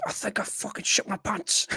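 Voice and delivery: put-on quoting in a Scottish accent. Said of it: "that was a good Scottish accent then".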